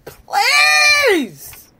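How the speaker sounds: squeaky, pleading "please"; high plea. Real, not acted. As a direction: beg the crowd with a cry. Pleading to African Americans.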